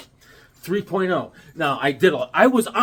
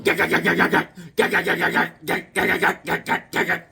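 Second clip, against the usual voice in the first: rapid mock gunfire; chopper gunfire noise; faster than usual. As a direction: rattle it off like a machine gun. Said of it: "hanging off a chopper going".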